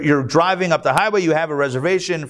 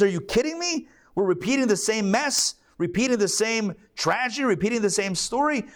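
Moses exasperated; 7 semitones higher than usual. Retold or imitated. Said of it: "Moses says".

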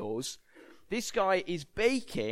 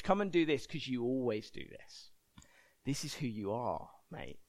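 low, soft, insinuating tempter; sin's tempting voice; 6 semitones lower, 7 dB quieter than usual. Acted. Sin coaxing the preacher back to old habits, low. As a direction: drop low and coax, matey. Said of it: "what sin says to me".

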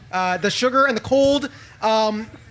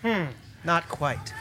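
hushed scene setting; 3 semitones lower, 4 dB quieter than usual. A narrator's reading. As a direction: build awe as it descends. Describes something descending from heaven.

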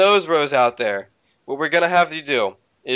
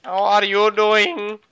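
goofy put-on voice; 6 semitones higher, slower than usual. Mock greeting in put-on voice.

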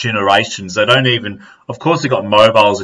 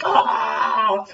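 high, restrained wail of pain; mock pained cry; 9 semitones higher, 6 dB quieter than usual. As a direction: imitate yourself crying out in agony. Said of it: "start fucking crying from pain".

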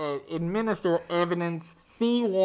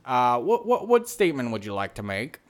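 normal talking voice

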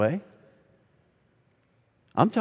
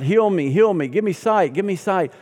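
mock crying out; 6 semitones higher than usual. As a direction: ham up the begging crowd. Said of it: "they're crying out".

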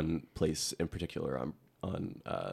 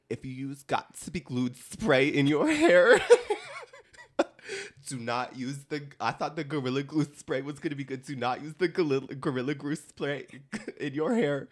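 mock viral video girl; 8 semitones higher, 5 dB louder than usual. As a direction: do the girl from the video, half laughing. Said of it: "so she's like".

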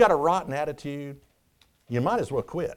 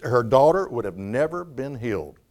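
ordinary talk

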